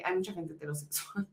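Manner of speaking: under her breath, uneasy in the crowd; quiet self-talk. Retold or imitated. Telling friend of her unease, murmured softly.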